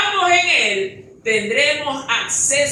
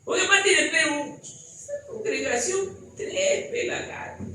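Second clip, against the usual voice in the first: higher, sneering gossip voice ending in a laughing whisper; mocking outsider; 10 semitones higher, 4 dB quieter than usual. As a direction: imitate a scoffer belittling the church. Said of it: "Personas de afuera". (imitación burlona de un crítico de afuera)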